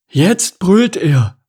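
high, slow, loud ostrich voice; Stanislav. A narrator's reading. Ostrich lookout reporting the lion, high-voiced. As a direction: announce it word by word up high.

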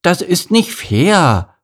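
put-on high, loud childish sulk; slow, high-pitched sulking complaint; sulky pout. A narrator's reading. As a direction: drag out the protest like a pouting boy. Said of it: "schmollte Lars".

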